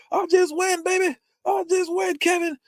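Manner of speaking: very high, strained lifter's boast; Lewis. Retold or imitated. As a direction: squeeze the boast out mid-rep. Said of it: "He goes".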